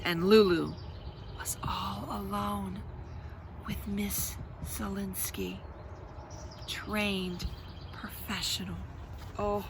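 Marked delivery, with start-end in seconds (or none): 1.4-9.6 hushed slow whisper. A narrator's reading. Reader whispers that Lulu is alone with sitter. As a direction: whisper it like a warning.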